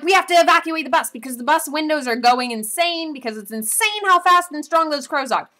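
high, loud frantic plea; panicked urging. Retold or imitated. Survivors urging everyone off the bus, loud and frantic. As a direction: rush them off the bus. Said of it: "everyone else is like".

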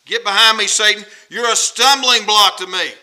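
loud, forceful reprimand; stern, hard-hit condemnation; strong rebuke. Acted. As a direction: drive the accusation home loudly. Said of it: "that's strong".

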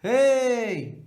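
high, loud hey; calling out hey. Shown as a demonstration. Teacher demonstrating a call, loud hey. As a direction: call out to get attention. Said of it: "Nasıl mesela?".

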